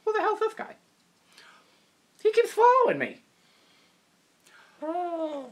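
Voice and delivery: high, indignant puppet voice; puppet complaining. Acted. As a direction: whine that the other puppet is following. Puppet complains about another.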